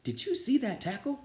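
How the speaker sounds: a teammate bubbling over; excited kid. A narrator's reading. Player tells the guys about the tackle, high and thrilled.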